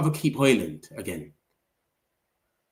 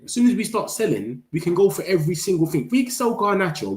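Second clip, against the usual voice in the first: excited; 7 semitones higher than usual. Real, not acted. Excited transfer hopes.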